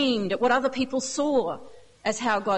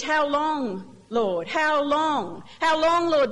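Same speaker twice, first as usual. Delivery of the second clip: anguished plea; 3 semitones higher, slower than usual. Acted. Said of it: "Deep anguish".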